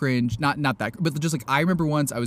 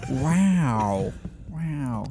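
half-hearted celebrity impression; tentative, fading attempt at an actor's catchphrase; Owen Wilson. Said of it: "No, I can't do it".

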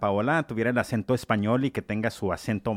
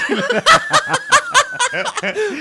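loud laughter; 8 dB louder than usual. Real, not acted. Bursting out after the insult joke.

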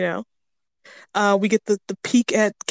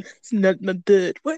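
slow, exaggerated mock Russian; cartoon Russian accent; slower than usual. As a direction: drag it out in a bad Russian accent. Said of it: "like a cartoon Russian accent".

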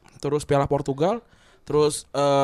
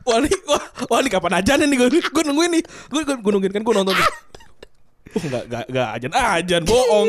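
excited acted quote; 5 semitones higher than usual. Acting out waiting for prayer call.

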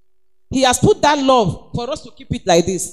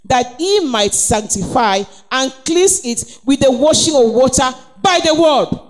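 normal talking voice

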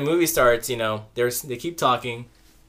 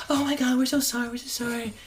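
high, gushy mock apology; mock apologetic; 10 semitones higher than usual. Talkative moviegoers told the movie started, high mock apology. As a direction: apologise in a squeaky imitation, softening off.

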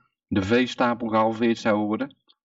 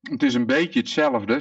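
talking with mouth full; 13 semitones higher than usual. Real, not acted. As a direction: speak through a mouthful of food. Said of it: "ik heb mijn mond vol met eten".

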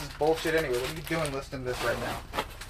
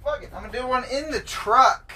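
mock decision; 9 semitones higher, 5 dB louder than usual. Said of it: "So I was like".

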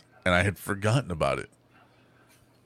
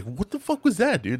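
high-pitched incredulous question; mock alarm, voice jumping up high; shocked disbelief; 12 semitones higher than usual. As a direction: imitate a stunned coworker. Retold coworkers' shocked question.

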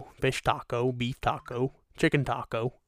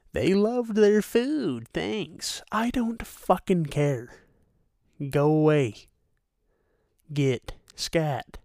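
mocking the host; 6 semitones higher, slower than usual. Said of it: "says something like".

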